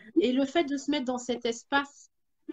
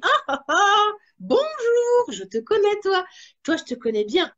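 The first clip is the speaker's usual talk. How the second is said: mock greeting; 8 semitones higher, 7 dB louder than usual. Greeting her own fear.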